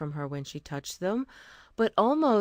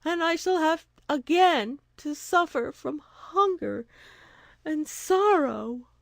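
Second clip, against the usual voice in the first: tearful pleading; 11 semitones higher, 5 dB louder than usual. A narrator's reading. Two Eyes telling the wise woman her goat is dead, weeping. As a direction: sob it out, barely holding together. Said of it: "she replied".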